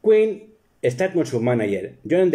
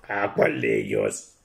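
much lower, softer deadpan; dry comeback; 14 semitones lower, 4 dB quieter than usual. Retold or imitated. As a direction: mutter it dryly as the husband. (réplica seca en voz mucho más grave)

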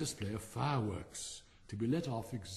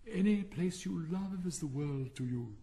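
lightly voiced wistful wheel; a hint of a lovelorn lady; pensive Catherine Wheel; 6 semitones higher than usual. A narrator's reading. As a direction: sigh it out pensively. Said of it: "exclaimed the pensive Catherine Wheel".

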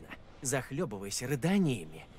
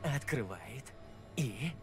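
ghost story; 4 dB quieter than usual. Acted. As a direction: drop the voice, draw it out. Tells a spooky tale.

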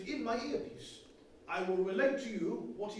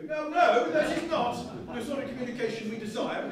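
indignant protest; 3 semitones higher, 6 dB louder than usual. Acted. Indignant reply to a mocking telegram.

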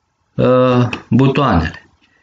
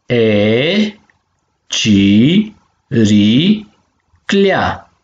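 clea split as ci, ri, clea with gaps; syllable by syllable; much slower than usual. Shown as a demonstration. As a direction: sound out ci - ri - clea in separate, drawn-out beats. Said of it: "So de silabe si". (pe silabe: ci, ri, clea)

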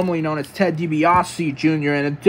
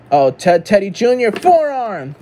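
louder call, rising high on the strike; excited play-by-play; 4 semitones higher, 5 dB louder than usual. Pretend. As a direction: punch out the name of the move.

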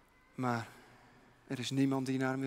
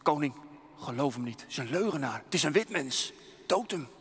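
urgent warrior. Acted. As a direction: push the king to act, urgently. Warrior urges king to kill.

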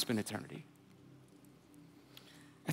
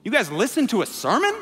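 incredulous; 6 semitones higher, 6 dB louder than usual. Retold or imitated. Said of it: "Sanhedrin just go".

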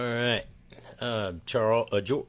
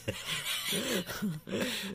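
high giggle; 11 semitones higher, 4 dB quieter than usual. Real, not acted. Laughing at the player name.

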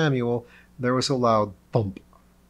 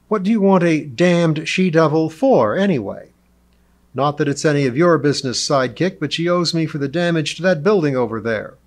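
loud, raised-pitch quarrelling; irritated outburst; 4 semitones higher, 7 dB louder than usual. A narrator's reading. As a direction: argue heatedly and dismissively. Lionheart quarrels with Lee.

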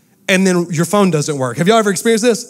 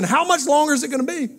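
put-on whiny kid; 8 semitones higher, 4 dB quieter than usual. Kids begging Daddy about the Wi-Fi, high whiny child voice. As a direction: plead like a panicked little kid. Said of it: "they kept asking".

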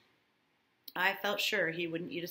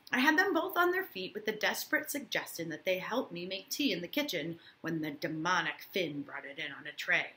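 ordinary talk, nothing marked

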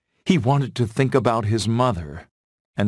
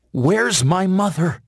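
high-pitched, urgent question; young fawn Bambi; 9 semitones higher, 4 dB louder than usual. A narrator's reading. Wounded Bambi to Feline about his mother, high urgent asking. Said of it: "he asked".

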